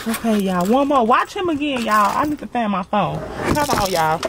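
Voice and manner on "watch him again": ordinary talk, nothing marked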